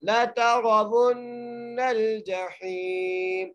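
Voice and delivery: very slow chant, thick ra in तरवुन्नल; nasal ghunna on the doubled nun; heavy ra. Shown as a demonstration. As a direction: recite ला तरवुन्नल जहीम melodically. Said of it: "रा को मोटा करके पढ़ेंगे".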